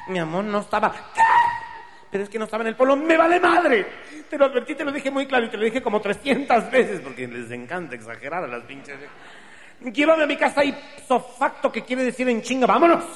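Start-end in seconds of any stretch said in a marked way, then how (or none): 0.1-3.0 put-on flustered girlfriend. Girlfriend excusing herself. 3.0-7.0 much higher, louder, fast angry outburst; furious rant. Acted. Furious boyfriend ranting at his girl, high and loud. 9.8-13.1 much higher, louder falsetto order; shrill demand. Acted. Offended girlfriend ordering him to take her home, shrill.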